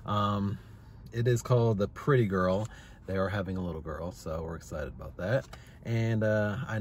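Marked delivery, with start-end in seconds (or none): none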